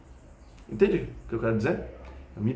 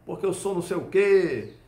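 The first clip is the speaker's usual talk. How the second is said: mock tough guy; 6 semitones higher than usual. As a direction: ham up the angry big guy's boasting. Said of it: "Aí o cara". (valentão imitado, mais agudo e alto)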